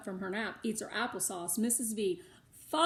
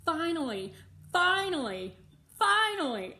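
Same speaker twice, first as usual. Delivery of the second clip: each finally higher and louder; building joy; 7 semitones higher, 5 dB louder than usual. A narrator's reading. Melody's long wait finally over, rising excitement.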